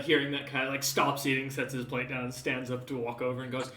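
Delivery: ordinary delivery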